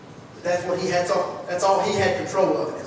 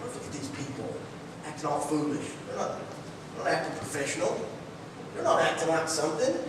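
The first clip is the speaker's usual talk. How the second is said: softer, sneering mock quote; mocking Pharisees; 4 semitones lower, 9 dB quieter than usual. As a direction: scoff at the worshippers, quietly. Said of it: "They began to mock it".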